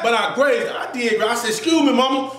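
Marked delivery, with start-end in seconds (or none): 1.5-2.3 polite excuse me. Retold or imitated. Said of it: "just like that".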